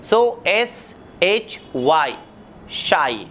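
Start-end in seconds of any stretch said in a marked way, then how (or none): 0.0-2.1 एस एच वाई spelled out slowly; spelling demo. Shown as a demonstration. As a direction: spell shy letter by letter. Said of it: "देखिए". (धीरे-धीरे spelling)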